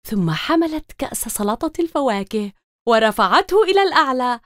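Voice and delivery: ordinary delivery, nothing marked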